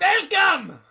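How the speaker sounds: put-on very high, loud German-accented yell; hammed-up German shout. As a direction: belt it out in a mock German accent. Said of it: "That is [name] shouting "Welcome".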